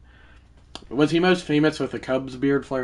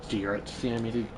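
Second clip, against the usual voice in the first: ordinary talk, nothing marked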